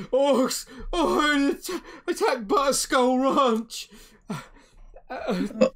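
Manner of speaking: high, loud, stammering panic; panicked young messenger. Acted. Shaken young farmer to the innkeeper, frantic wailing. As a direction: cry out the awful news in bursts. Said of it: "he looks quite shaken up".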